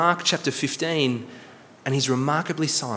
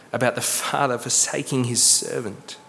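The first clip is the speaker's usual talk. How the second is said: ordinary talk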